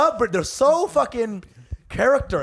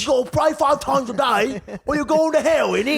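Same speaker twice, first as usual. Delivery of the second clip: mock Birmingham accent; 7 semitones higher than usual. Comic voicing a Birmingham-raised guy, high Brummie accent. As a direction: play a Brummie lad. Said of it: "You say".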